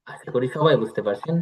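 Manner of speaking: ordinary delivery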